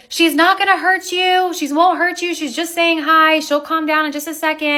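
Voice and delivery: high soothing reassurance; calm her down, sweetly and high; reassuring. Retold or imitated. Telling the scared neighbor her dog is harmless, high and soothing. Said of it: "and I said".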